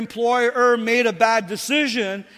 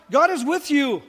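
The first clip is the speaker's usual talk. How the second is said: ordinary talk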